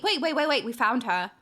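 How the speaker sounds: ordinary delivery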